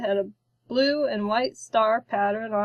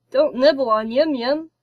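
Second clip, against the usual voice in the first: louder, changed-voice telling-off; scolding; 3 semitones higher, 6 dB louder than usual. A narrator's reading. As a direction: scold the hatchling firmly. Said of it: "despite the scolding".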